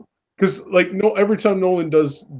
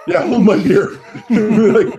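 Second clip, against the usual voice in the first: laughing as the words come out, louder; 7 semitones higher, 5 dB louder, much slower than usual. Real, not acted. Laughing at a friend's joke about Nolan, louder. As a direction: laugh through hold my beer.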